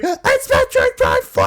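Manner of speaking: high, loud ecstatic shriek; reenacted scream. Fan reliving the reveal to viewers, ecstatic shriek. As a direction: shriek the name like a fan losing it. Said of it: "I was just screaming".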